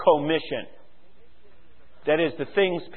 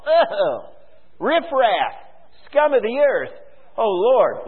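mock sneer at the riffraff, pitched higher; condescending sneer; 5 semitones higher, slower than usual. Preacher mocking a condescending churchgoer, sneering. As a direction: look down your nose and scoff. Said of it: "looking at them in some condescending way and go".

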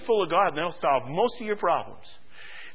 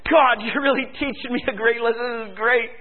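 mildly excited, raised-pitch prayer; delighted; 6 semitones higher, 4 dB louder than usual. Retold or imitated. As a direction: marvel happily to God, acting it out. Retold delight while studying.

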